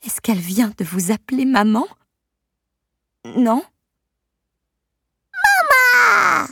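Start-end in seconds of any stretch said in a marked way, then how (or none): 3.2-6.5 very high, loud delighted cry; baby shrieking happily; joyful baby shout. Acted. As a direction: shout it with toddler glee. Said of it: "Crie joyeusement la petite".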